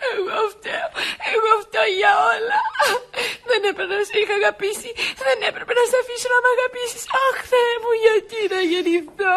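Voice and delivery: tearful cries, blaming herself between sobs; sobbing despair. Acted. Doris sobbing to Henry, crying in despair. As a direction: weep and wail through the words. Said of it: "γιατί κλαις".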